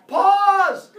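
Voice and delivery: loud high yell; yelled Pause. Shown as a demonstration. Teacher demonstrating to students how she'll stop them. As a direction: shout it to cut off the filler. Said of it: "I'm gonna yell".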